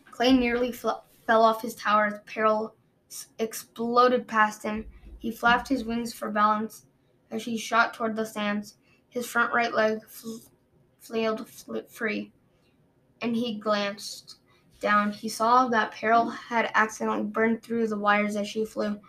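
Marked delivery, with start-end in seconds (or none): none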